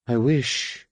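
a touch of wistful longing. A narrator's reading. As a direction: let it trail off longingly. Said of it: "trailed off wistfully".

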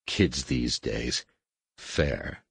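put-on low, slow grumble at the kids; weary scoff. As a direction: mutter it like a tired old dad. Han mutters about kids.

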